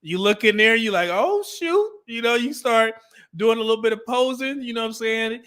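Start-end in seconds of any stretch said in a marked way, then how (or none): 1.1-1.9 high-pitched mock "oh shoot" surprise; pleasantly startled, voice jumping up; impressed surprise. Gym-goer catching himself in the mirror, high mock surprise.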